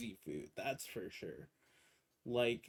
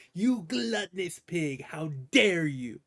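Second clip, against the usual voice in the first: loud mock scolding, higher; mock outrage; 3 semitones higher, 6 dB louder than usual. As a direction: scold like an offended preacher. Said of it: "That kinda came out".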